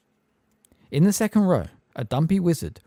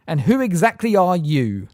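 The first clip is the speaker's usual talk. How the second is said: bored and lofty; 7 semitones higher, 7 dB louder than usual. A narrator's reading. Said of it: "in a bored and lofty voice".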